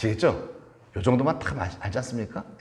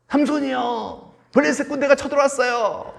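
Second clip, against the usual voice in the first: shouted signal; 3 dB louder than usual. Acted. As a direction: cry out that soldiers are invading. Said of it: "이 신호를 보내니까".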